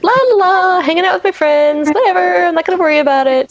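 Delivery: a careless airhead humming along; carefree singsong. Retold or imitated. Fan mocking a carefree character, breezy singsong. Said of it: "is kind of being like".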